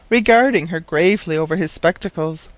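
plain reading voice

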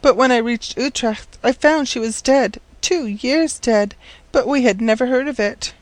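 put-on high-pitched, earnest girl's voice; forlorn orphan girl. Orphaned girl explaining to the professor why she came, forlorn.

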